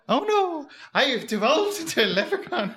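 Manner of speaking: high, laughing Irish leprechaun. Pretend. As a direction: play a giddy leprechaun, way up high. Leprechaun bit, bouncing around.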